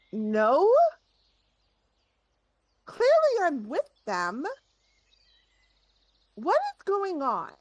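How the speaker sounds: indignant, pitch jumping high; high, heated outburst; very angry. Acted. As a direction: demand an explanation, incredulous and cross. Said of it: "Very angry".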